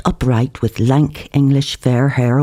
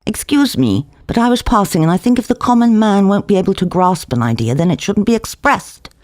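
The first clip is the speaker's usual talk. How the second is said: put-on middle-aged girl; 6 semitones higher than usual. A narrator's reading. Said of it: "said a middle-aged girl going by the door".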